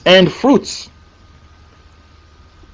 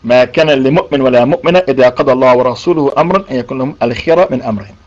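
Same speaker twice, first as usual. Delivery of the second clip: quick, low-pitched recitation of the verse; Quran recitation; 6 semitones lower, much faster than usual. Acted. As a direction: recite it swiftly, like reading scripture.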